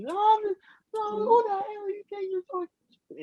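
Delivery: very high, squealed imitation of an angry customer; mock yelling. Angry customer at Kroger arguing back, high mock yell. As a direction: imitate her yelling in falsetto, without real volume. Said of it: "then she started yelling".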